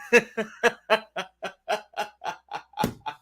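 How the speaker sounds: long high-pitched laugh. Real, not acted. Laughing at own villain act.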